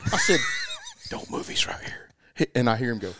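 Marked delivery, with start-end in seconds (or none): 1.1-2.1 sharper urgent whisper. Retold or imitated. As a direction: hiss it a little harder. Repeated whisper to partner.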